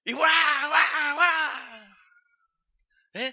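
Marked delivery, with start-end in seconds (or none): 0.0-2.0 high, loud mock baby crying; imitated baby wail. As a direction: wail like a hungry newborn.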